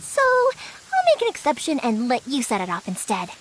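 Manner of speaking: ordinary delivery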